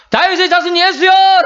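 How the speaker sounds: high, drawn-out cry to be noticed; loud shout. Acted. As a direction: call out loudly over a crowd. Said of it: "소리 크게 치는 거예요". (높고 크게 외치는 소리)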